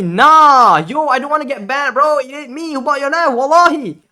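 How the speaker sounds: high, loud, urgent plea; pleading denial. Retold or imitated. Streamer swearing to the scammer he bought nothing, high urgent plea. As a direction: beg him to believe you.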